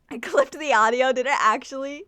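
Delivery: laughing loudly through the words. Real, not acted. Streamer laughing that her burp clipped the mic, loud laughing. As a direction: burst out laughing mid-sentence.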